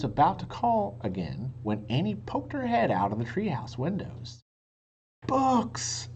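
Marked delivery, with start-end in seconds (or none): 5.3-6.1 excited shout. A narrator's reading. Annie calls down from the treehouse, high and thrilled. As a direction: shout the discovery down. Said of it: "Annie shouted".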